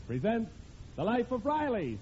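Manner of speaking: usual speaking voice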